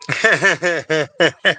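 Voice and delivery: high rapid-fire giggle. Real, not acted. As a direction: burst into a high chuckle at your own joke. Laughs at own joke.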